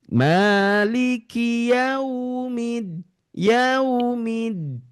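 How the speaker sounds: slow Maliki yaumiddin, yau gentle; soft yaumiddin. Shown as a demonstration. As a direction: let yaumiddin flow softly. (yaumiddin lembut)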